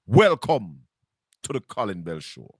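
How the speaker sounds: ordinary delivery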